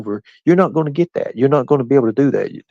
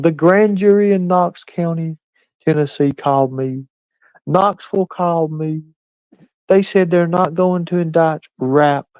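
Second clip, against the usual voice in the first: high, slow mock imitation of Nicolina; 7 semitones higher than usual. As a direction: parody her sworn claims in a higher voice. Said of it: "Nicolina swearing up and down".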